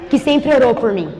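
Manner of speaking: low, soft, hushed storytelling; testemunho em voz contida. Real, not acted. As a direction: tell it low and quiet, holding back before the climax. Hushed, slow-building testimony storytelling. (voz baixa e contida de testemunho)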